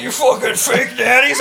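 mocking commenter voice.

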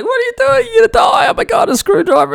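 high-pitched panicked shouting, imitated; freaking out. Handyman watching neighbour poke live electrics with a screwdriver, panicked. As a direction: shriek in hammed-up alarm at the danger. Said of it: "I'm freaking out".

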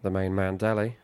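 lively play-by-play commentator voice; mock commentary. Friend plays commentator over the match, live commentary. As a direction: call the match like a TV pundit.

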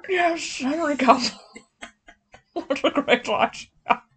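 mock a creaky aged king; hammed-up old man voice breaking into laughs; terrible old man voice. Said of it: "doing this terrible old man accent".

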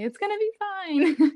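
high, drawn-out sing-song reassurance; mock self-reassurance. Student joking about staying calm, high slow mock reassurance. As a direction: stretch it out high, about to laugh. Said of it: "take a deep breath and say".

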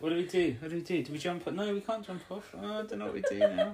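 high, flustered mock panic; squeaky hammed-up rat panic; panicking rats. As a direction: play the rats losing their heads, laughing through it. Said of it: "So they're like".

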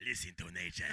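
very low, soft narration; mock nature narrator. Narrator inviting listeners to hear the call, mock documentary. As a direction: hush the listeners, low and reverent.

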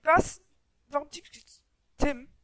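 shouting at a teamkiller; angry yell at a teammate; raging gamer. Real, not acted. Teammate gets teamkilled in game. (wütender Gamer)

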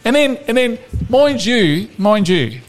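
podcast host ranting at a footballer, comically exasperated; mock rant. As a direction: rail at the player like a fed-up fan. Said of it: "this is my rant".

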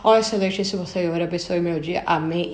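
a hint of stiff, ceremonious prayer; mock formal prayer. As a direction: rattle off a formal prayer by rote, mockingly. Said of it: "a gente fala". (leve imitação de oração formal e decorada)

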